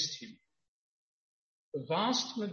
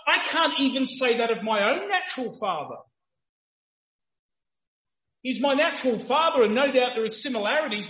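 higher, louder impassioned preaching; full-force preaching; 7 semitones higher, 8 dB louder than usual. Acted. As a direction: drive the point home to the crowd.

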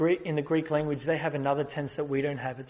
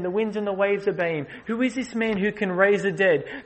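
louder, higher, driving preacher voice; building sermon climax; preaching at full force; 6 semitones higher, 7 dB louder than usual. Acted. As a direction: preach it out to the congregation. Astonished crowd, preached.

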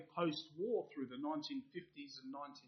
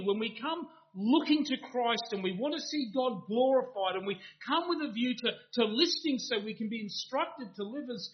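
louder, higher impassioned sermon voice; full-force preaching; 6 semitones higher, 8 dB louder than usual. Acted. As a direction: drive each clause home to the congregation.

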